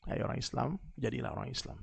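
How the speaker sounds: quiet, low plea after the shout; preacher quietly urges the faithful; soft appeal. Acted. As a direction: drop to an earnest hush.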